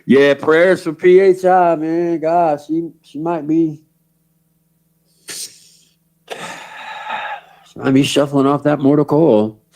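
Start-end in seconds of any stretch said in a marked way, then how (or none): none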